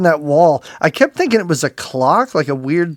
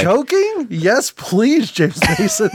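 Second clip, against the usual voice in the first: higher, breathless swoon; 6 semitones higher, slower than usual. Pretend. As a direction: gush like a starstruck fan. Swooning at James Mason.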